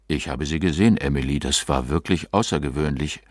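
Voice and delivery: low-pitched, subdued man's voice; sober husband. A narrator's reading. Husband's sober reply to wife.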